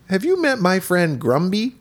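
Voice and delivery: high-pitched toy bear voice; high, put-on children's character voice; Teddy Ruxpin. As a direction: introduce yourself like a talking teddy. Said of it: "who did the voice".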